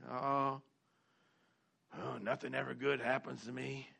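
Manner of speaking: low, soft, droopy imitation of a defeated complainer; mock defeated moan. Preacher mimicking a defeated congregant's gloomy complaint, mock mopey moan. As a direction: sag into a mopey, self-pitying moan.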